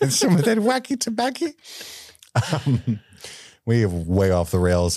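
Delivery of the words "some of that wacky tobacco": high, squeaky elf dealer; Dobby impression. Dobby bit returns.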